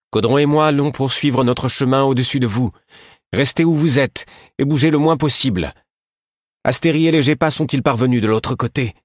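high, loud instructions; calling out. Acted. Queen calling down to Fitz stuck on the rocks. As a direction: shout the plan down the slope. Ketriken shouts instructions from above.

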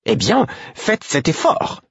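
high, slow gruff crone; gruff old woman. Acted. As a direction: grumble the advice impatiently. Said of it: "me conseilla Caudron d'un ton bourru". (vieille femme bourrue, aiguë et lente)

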